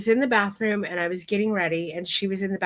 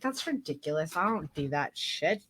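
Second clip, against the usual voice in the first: muttered to herself; 4 semitones lower, 8 dB quieter than usual. Retold or imitated. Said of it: "I was like".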